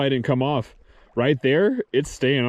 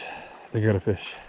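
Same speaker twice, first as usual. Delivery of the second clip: quietly, holding still; softer; 5 semitones lower, 7 dB quieter than usual. Real, not acted. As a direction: say it low so the fish won't notice. Repeating more softly.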